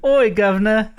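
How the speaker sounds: hammed-up British street greeting; mock British. Co-host joking with the hosts, mock British accent. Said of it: "mimicking, uh, British culture".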